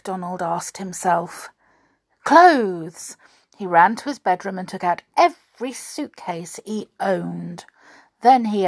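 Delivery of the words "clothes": sudden realisation. Acted. Donald realises what to pack.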